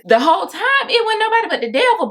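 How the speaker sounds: high-pitched, quick indignant outburst; emphatic indignation. Real, not acted. Indignant climax about exploitative client.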